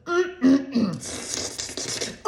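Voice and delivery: slow leering whisper; whispered creepy driver impression. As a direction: breathe it like a creepy flirt. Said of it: "And he's just like".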